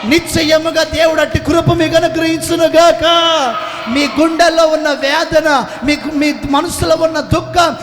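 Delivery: loud rolling sermon cadence, often shouting; fervent preaching. Acted. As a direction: preach it out to the whole hall. Preaching to congregation. (ఉద్వేగంతో గట్టిగా ప్రసంగం)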